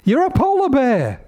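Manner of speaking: very high, pressing mum; exasperated mother bear. Acted. Mother bear to her cub, very high and exasperated. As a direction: push the cub for a yes.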